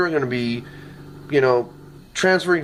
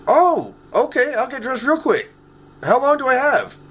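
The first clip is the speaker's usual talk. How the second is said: higher, eager "oh, okay" that drags a little at the start; eager acceptance; 8 semitones higher than usual. Retold or imitated. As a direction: act thrilled to be asked out on tour. Imagined reply to [name]'s call.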